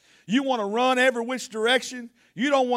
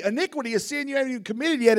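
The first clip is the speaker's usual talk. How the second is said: ordinary talk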